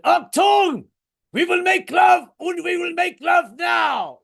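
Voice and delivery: put-on high, shouted German accent. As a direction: bellow it as a commanding German lover. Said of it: "uh, the German accent".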